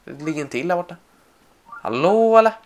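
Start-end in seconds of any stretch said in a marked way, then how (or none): 1.7-2.5 loud, high call across the field. Real, not acted. Player calling to the sleepers, loud and high. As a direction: shout to wake them up.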